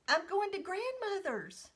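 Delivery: put-on high, bright child's voice; innocent little girl. Girl in the box telling the mailman, innocent child voice. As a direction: announce it proudly like a toddler.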